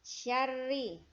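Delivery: Syarri with a short sya and doubled rr; short syllable demo. Shown as a demonstration. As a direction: sya kept short, rr held. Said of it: "syanya pendek".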